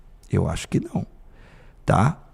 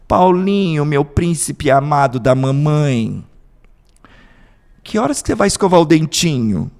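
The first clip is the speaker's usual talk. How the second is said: doting mother; 3 semitones higher, 6 dB louder than usual. Retold or imitated. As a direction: coo at him like a doting mom. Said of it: "É assim". (mãe melosa chamando o filho com carinho)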